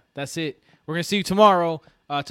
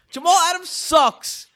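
higher, slower, dragged-out insult; slower angry repeat; 13 semitones higher, 4 dB louder, slower than usual. Real, not acted. Fan repeats the insult to his cohosts, slower and heated. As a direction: lean on every word the second time.

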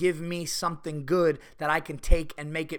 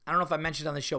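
usual speaking voice

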